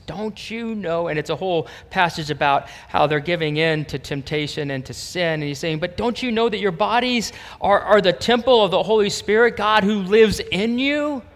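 loud, high, driving sermon climax; fiery preaching. Acted. Pastor rousing his congregation about God's presence, in loud high fervent preaching. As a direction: preach it out to the whole room.

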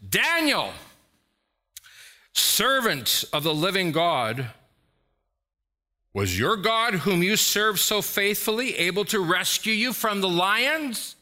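Daniel called out high and loud in anguish; anguished cry. A narrator's reading. King calling into the lions' den for Daniel, anguished high cry. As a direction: cry his name out in raw grief, high and loud. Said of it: "And he cried out".

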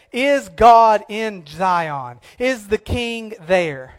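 drawn-out, very slow questioning; slow rhetorical question; slow searching question. Retold or imitated. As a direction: stretch each word as if searching. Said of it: "they said".

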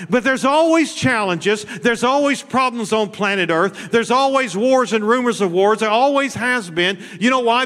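high, loud, rousing sermon cry; preaching at full force. Acted. Preacher rallying his congregation to blow their trumpet, preaching at full force. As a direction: preach it hard to wake up the church.

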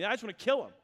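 mock exasperated threat; mildly worked-up, at the end of patience; fed up. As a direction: act out being done with them. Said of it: "God was done with them".

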